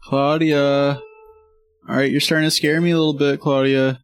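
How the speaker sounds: calling out anxiously. Acted. Searching for a vanished companion.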